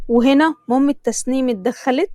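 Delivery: ordinary delivery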